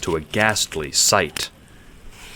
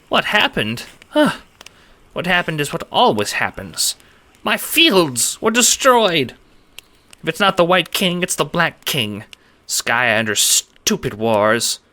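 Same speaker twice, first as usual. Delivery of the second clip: high, squeaky voice, tired and fed up; a small creature complaining about endless wars; squeaky little man, weary; 10 semitones higher than usual. A narrator's reading. Farmer lamenting his ruined fields to strangers, squeaky.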